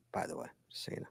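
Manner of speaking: soft whisper, almost no voice; whispered aside. Real, not acted. Host to co-host, a whispered aside about the time. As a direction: slip it in under your breath.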